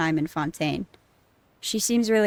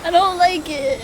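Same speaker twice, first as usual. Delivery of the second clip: high, loud, distressed; real dismay, voice up and loud; upset outcry; 9 semitones higher, 5 dB louder than usual. Real, not acted. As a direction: cry out against the sad ending. Upset at a character's farewell.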